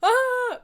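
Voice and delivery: short high mock scream; scream as distraction. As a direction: let out a brief, loud fake scream. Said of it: "I should scream now".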